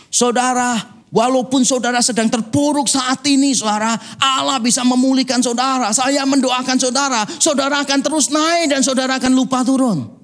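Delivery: high, sing-song pulpit voice, a notch above his own; mocking a preacher. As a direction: ham up an overeager pastor promising riches. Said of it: "Pendetanya khotbah gini". (meniru pendeta berkhotbah dengan nada tinggi)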